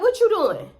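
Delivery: high loud disbelieving question; bewildered what are you doing; shocked. Retold or imitated. Shocked he got in her car.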